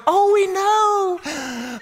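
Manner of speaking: high, slow, sugary "Oh, we know"; engaged couple cooing at the pastor; smiling sing-song. Retold or imitated. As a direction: sing it out high and drawn like a blissful fiancée. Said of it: "they just smile and go".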